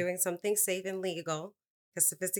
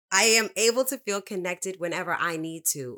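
emphatic affirmation; 2 semitones higher, 8 dB louder than usual. Acted. Reading affirmation aloud, emphatic.

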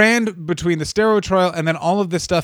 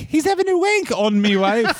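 put-on higher, laughing British accent; hammed-up Midlands-ish British accent; mock British accent; 8 semitones higher than usual. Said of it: "you try an accent".

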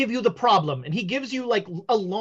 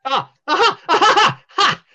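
loud, much higher laugh; stagey seer laugh; 7 semitones higher, 9 dB louder than usual. Acted. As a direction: laugh like a showman. Fortune teller laughs.

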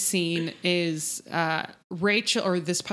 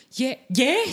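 Ian; 5 semitones higher, 3 dB louder than usual. Retold or imitated. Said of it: "So Ian is like".

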